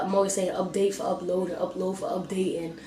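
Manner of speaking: ordinary talk, nothing marked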